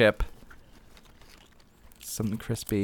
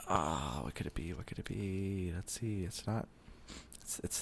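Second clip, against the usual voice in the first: quiet musing; 12 dB quieter than usual. Real, not acted. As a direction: muse softly to yourself. Musing quietly while guessing.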